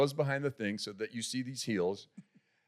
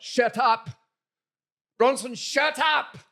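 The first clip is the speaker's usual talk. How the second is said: high, loud, slow British bark of shut up, Bronson; misheard shut up; 11 semitones higher, 7 dB louder, much slower than usual. Retold or imitated. British director's shirt up heard as a yelled shut up. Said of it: "But it comes out".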